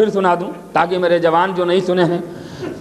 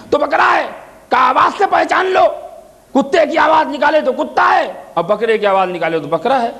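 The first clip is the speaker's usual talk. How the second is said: preaching at full force; 5 semitones higher than usual. Acted. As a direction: ring out each answer to the crowd. Ali's answers preached loud.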